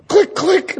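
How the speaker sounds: two sharp, very high clicks for closing handcuffs. Retold or imitated. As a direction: snap the cuffs shut with high mouth clicks.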